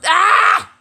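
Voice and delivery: loud high scream of frustration; frustrated scream. Acted. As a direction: let out a sudden yell. Teacher frustrated outburst.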